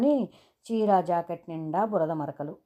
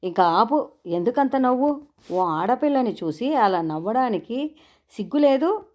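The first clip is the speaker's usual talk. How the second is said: higher, raised scolding voice; scolding principal; 5 semitones higher, 6 dB louder than usual. A narrator's reading. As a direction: tell the boy off sharply. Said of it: "ఒక్క గదుము గదిమాడు principal".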